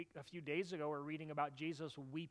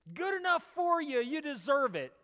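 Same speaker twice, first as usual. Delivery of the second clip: much higher, louder mock gloat; gloating jab; 9 semitones higher, 10 dB louder than usual. Jesus, in a pretend gloat over Jerusalem, mockingly loud. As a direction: spit it out like a smug told-you-so.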